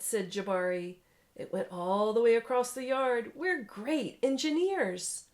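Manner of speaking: lively read-aloud storytelling voice; story narration. A narrator's reading. Parent reading a picture book to a child, animated narration.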